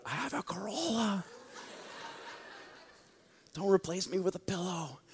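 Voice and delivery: put-on high, soft goofy voice of a boyfriend; mock pickup line. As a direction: mimic a guy bragging about his Corolla. Joke voicing a guy's pickup line.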